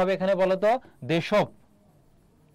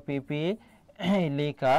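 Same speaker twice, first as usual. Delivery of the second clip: drawn out slowly; 3 semitones lower, much slower than usual. Shown as a demonstration. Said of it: "পিপিলিকা বানানটা গুরুত্বপূর্ণ".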